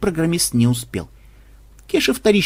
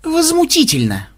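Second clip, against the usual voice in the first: indignant; 12 semitones higher than usual. Acted. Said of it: "недовольно буркнул Ксенобайт".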